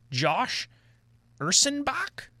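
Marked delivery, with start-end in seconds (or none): 1.4-2.3 Ursenbach asked with a high rise; unsure pronunciation. Real, not acted. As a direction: Ursenbach tried out as a question. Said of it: "Am I saying that right?".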